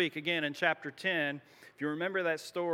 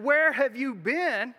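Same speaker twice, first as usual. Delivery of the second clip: higher, louder, slow indignant question; exasperated demand; 7 semitones higher, 7 dB louder, slower than usual. Retold or imitated. Saul demanding why Samuel came late, loud and exasperated. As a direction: throw it at him, fed up with waiting.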